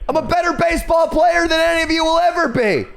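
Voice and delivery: high yelled taunt; shouting. Acted. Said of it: "start calling those guys assholes".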